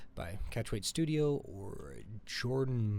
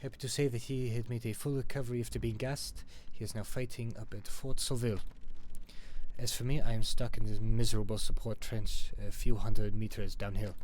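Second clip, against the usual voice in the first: put-on loud, declaimed amateur French accent; 9 dB louder than usual. Soldier writing home to Ma from Verdun, French accent. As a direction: read out the letter in a rough French accent. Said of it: "I gotta do a French accent".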